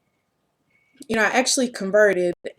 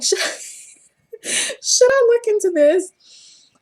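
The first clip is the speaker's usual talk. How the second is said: laughing; 12 semitones higher, 3 dB louder, much slower than usual. Real, not acted.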